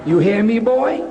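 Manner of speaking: low West Indian mother laying down the law; West Indian accent. Retold or imitated. Preacher quoting his mother instructing him, West Indian accent. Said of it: "in her beautiful West Indian accent".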